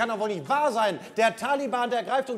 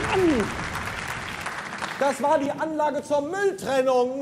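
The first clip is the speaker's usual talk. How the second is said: angry yell; 5 semitones higher, slower than usual. Acted. Captain yells after explosion.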